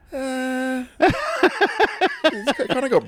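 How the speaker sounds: put-on high, giggly child answer; little kid voice. Kids describing a classmate.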